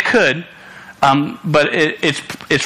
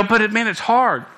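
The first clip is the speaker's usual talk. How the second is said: lightly imitated complaint, pitched up; mock protest; 7 semitones higher than usual. Preacher imitating a listener objecting, lightly whiny protest. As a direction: voice a listener's mild objection, higher. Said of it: "you go".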